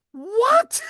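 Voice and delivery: high, disbelieving what; a jump in pitch in mock shock; incredulous high what; mock disbelief. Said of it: "I'm like".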